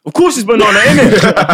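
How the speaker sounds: high, loud laughing put-on voice; laughing mock stranger. Stranger taps his chest and laughs, high loud put-on voice. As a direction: cackle it out as the stranger. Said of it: "he went".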